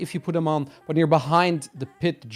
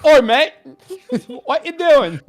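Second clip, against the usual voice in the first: loud, high, hammed-up British scolding; badly faked British telling-off, breaking into laughter; terrible British accent; 11 semitones higher, 10 dB louder, slower than usual. Character's alt catching you being naughty, terrible British accent. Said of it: "Sorry, that's a terrible accent".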